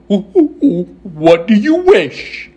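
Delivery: slow monkey voice after whooping hoots; hoot, then draw the question out; hooting Monkey King. Acted. Monkey King asking Dorothy's wish, slow hooting monkey.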